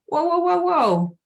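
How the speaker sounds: high, sing-song whoa whoa calming-down voice; playful high reassurance to a panicking mind; soothing inner voice. Retold or imitated. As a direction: talk your own panic down like a brain hitting the brakes. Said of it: "it sends a signal to your brain to say".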